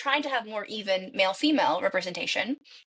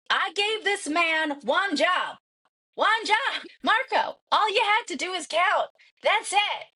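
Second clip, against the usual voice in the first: furious rant; 9 semitones higher, slower than usual. Retold or imitated. In her head at the Guinness official, furious shouting. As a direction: blast the official for one job, pitched way up.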